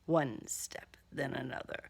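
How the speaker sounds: low whispered refrain. A narrator's reading. Narrator counting his steps from hiding, whispered. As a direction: whisper each step as he closes in.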